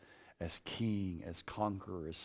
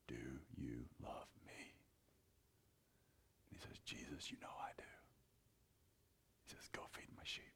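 hushed whisper, much softer; whispered reply; 8 semitones lower, 20 dB quieter than usual. Retold or imitated. Peter and Jesus on the shore, whispered. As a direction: breathe it out, barely voiced.

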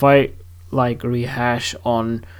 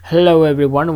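ordinary talk, nothing marked

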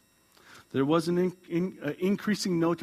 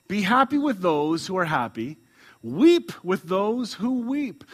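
scripture reading; 4 semitones higher, 5 dB louder, slower than usual. Acted. Reads scripture aloud.

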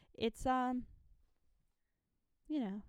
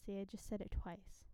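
usual speaking voice

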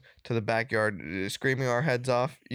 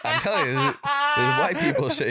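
talking through laughter, higher; laughing; 4 dB louder, faster than usual. Real, not acted. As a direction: say it while cracking up. Laughing while telling dad story.

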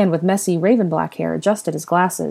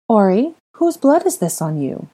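higher, careful anxious question; nervous; 5 semitones higher, 3 dB louder than usual. Acted. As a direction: ask the child nervously. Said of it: "Ginny asked nervously".

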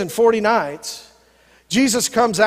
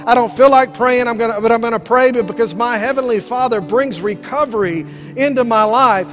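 building, louder preacher's declaration; preaching at full force; 3 semitones higher, 4 dB louder than usual. Acted. Pastor rallying the church, preaching louder and louder. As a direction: rise and drive it home to the congregation.

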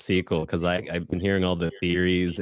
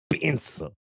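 put-on Salvadorian accent; 8 semitones higher, 3 dB quieter than usual. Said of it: "that's a different accent".

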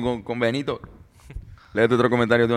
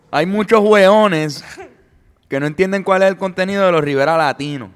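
mock Chilean commenter; 6 semitones higher, 5 dB louder than usual. Voicing a Chilean commenter, higher and louder.

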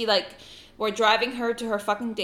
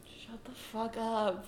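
quiet, drawn-out shock; stunned, under your breath; hushed disbelief; 11 dB quieter, slower than usual. Retold or imitated. Stunned hushed reply to dad.

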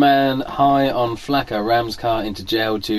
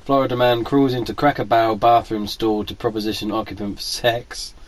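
ordinary talk, nothing marked

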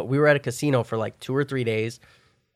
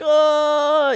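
dazed groan; 13 semitones higher, 4 dB louder than usual. Retold or imitated. Friend dazed after the crash.

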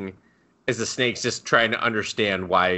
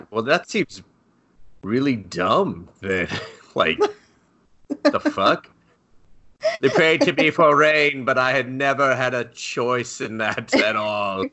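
serpent god; 4 semitones higher, slower than usual. Acted. Voicing the confused serpent god.

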